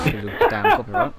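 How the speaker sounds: mock exasperation. Exasperated high fast outburst.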